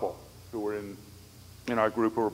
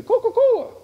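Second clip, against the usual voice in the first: much higher, much louder call; imitating friend; 12 dB louder than usual. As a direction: belt it high like him.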